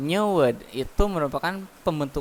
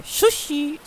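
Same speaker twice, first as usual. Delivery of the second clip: high-pitched Japanese-style sushi with "sh" at the start; Japanese accent; 13 semitones higher than usual. Shown as a demonstration. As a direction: correct it to shu-shi in a high Japanese accent. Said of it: "logat bahasa Jepangnya apa?".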